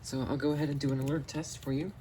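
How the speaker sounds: ordinary talk, nothing marked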